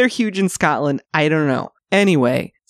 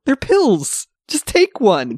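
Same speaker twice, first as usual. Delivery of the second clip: mock pushy; 8 semitones higher, slower than usual. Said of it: "He's like".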